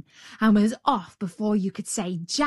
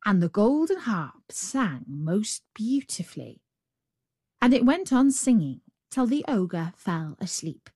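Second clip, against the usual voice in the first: ordinary delivery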